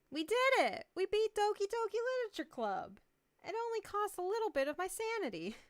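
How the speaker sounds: high, loud cheer of victory; excited. Real, not acted. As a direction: celebrate the win. Celebrating beating the game.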